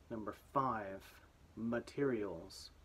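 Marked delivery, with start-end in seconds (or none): 1.5-2.7 materials said slowly; slow dictation. Shown as a demonstration. Teacher dictating: slow clear word. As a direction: spell it out slowly with the voice. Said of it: "here we go".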